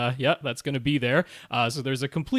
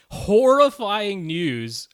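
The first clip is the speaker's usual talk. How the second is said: slow, drawn-out ominous words; spooky dramatic emphasis; 4 semitones higher, 5 dB louder, much slower than usual. Acted. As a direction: make it sound scary.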